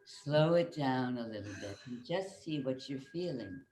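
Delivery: low, hushed coaching voice; soft, slowing down. Real, not acted. Coach winding down the exercise.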